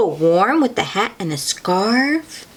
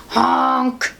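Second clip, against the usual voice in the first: higher-pitched goose honk; 4 semitones higher than usual. A narrator's reading. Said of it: "says the goose".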